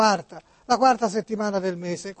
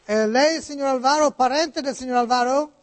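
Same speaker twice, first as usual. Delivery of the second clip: put-on Italian spoken with an American accent; 3 semitones higher than usual. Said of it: "come parla Niculo? ... oggi americani".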